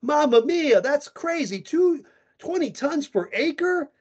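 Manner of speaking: exaggerated Italian accent, throw hands up; jolly Italian pizza chef. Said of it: "I'm Italian... what I say".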